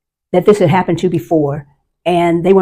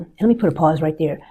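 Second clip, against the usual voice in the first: normal talking voice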